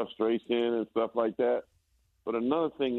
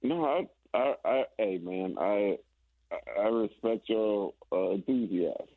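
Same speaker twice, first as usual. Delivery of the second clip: halting, restarting over and over; stuttering hesitation. Real, not acted. Guest answers the hype man's bold title prediction, stuttering. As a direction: stumble over your opening words, groping for a polite answer. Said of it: "don't start stuttering on me".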